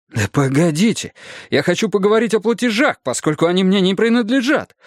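high-pitched, mildly outraged protest; indignant. Acted. Husband insists on discussing the payments, indignant. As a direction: object and drag the subject back. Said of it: "возмутился я".

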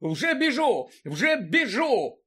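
high, drawn-out protest; harried yelp. A narrator's reading. Lookout fleeing the old woman, harried. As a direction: cry out while dodging blows.

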